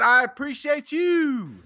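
high, drawn-out sing-out thanks; showy sign-off to a reviewer; catchphrase flourish. Real, not acted. Same catchphrase to next reviewer.

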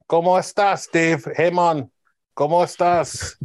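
hammed-up Mexican-Jamaican buddy accent; half Mexican half Jamaican accent. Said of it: "my weird half Mexican, half Jamaican accent".

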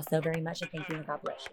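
soft fading farewell; fading sign-off. Real, not acted. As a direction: drift away on the blessing.

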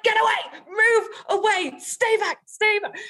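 high loud frantic get away; panicked shout. Retold or imitated. Hiding in the bush, she yells her colleague away.

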